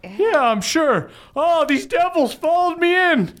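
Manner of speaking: put-on high-pitched old warrior voice; Bev Senior speaking. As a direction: lift the voice up high.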